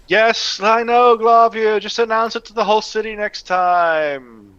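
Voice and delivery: high, exasperated sarcasm; sarcastic reply to an indiscreet friend. Acted. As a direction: call back with weary, mocking agreement. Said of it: "I completely missed the sarcasm".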